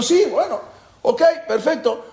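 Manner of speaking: high, loud, eager yes-men; eager agreement. Retold or imitated. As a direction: cheer the plan on like a tipsy crowd. Drunk guests eagerly agreeing. (asentimiento entusiasta y agudo)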